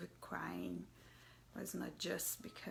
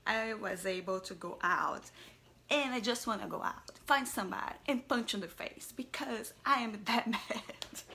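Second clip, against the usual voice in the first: mock angry mom; 2 semitones higher, 8 dB louder than usual. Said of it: "and I start to think".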